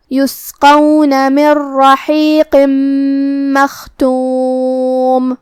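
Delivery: slow, drawn-out melodic tajweed chant; Quran recitation. Shown as a demonstration. Teacher reciting the verse for students, in slow melodic recitation. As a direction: recite each syllable long and measured. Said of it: "recites the verses".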